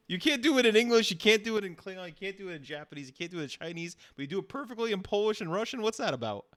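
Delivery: high-pitched exasperated run-down; exasperated complaint. Real, not acted. Host complains about failed language attempts.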